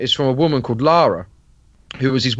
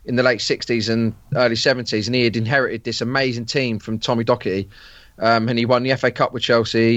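normal talking voice

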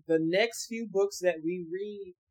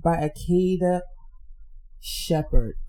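slow repeat; 6 dB louder, slower than usual. Shown as a demonstration. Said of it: "One more time".

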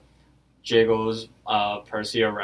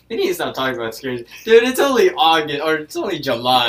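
loud, high plea to stop; louder and higher complaint about spooky talk too early; protesting; 4 semitones higher, 7 dB louder, faster than usual. Real, not acted. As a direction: beg them to quit the scary talk. Friend begging to stop scary talk.

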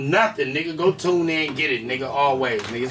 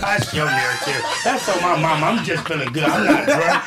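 ordinary talk, nothing marked

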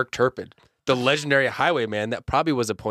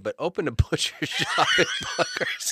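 laughing. Real, not acted. Breaks up laughing mid-sentence.

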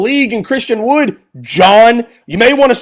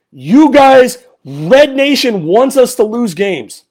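ordinary delivery

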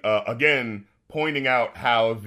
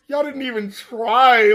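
incredulous scoff; 9 semitones higher, 6 dB louder, slower than usual. Retold or imitated. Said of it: "I was like".

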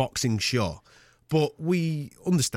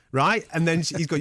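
ordinary talk

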